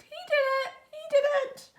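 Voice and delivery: very high squawking parrot. A narrator's reading. Parrot on Pete's shoulder, high squawk.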